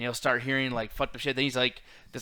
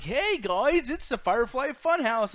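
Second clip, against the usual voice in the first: much higher, mock cheery kids' show host; Bray Wyatt kids' host; 10 semitones higher, 4 dB louder, slower than usual. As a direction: mimic a sugary children's TV greeting. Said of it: "Mister Rogers' Neighborhood type thing".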